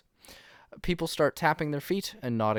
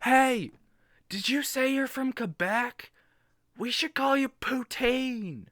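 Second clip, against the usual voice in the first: mild shout from audience; 12 semitones higher than usual. Acted. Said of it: "a tall fellow shouts from the audience".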